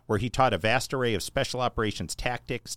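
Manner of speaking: ordinary delivery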